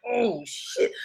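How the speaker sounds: whispered oh shit; breathy inner curse; hushed dismay. Retold or imitated. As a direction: say it to yourself, caught out. Inner thought caught in lie.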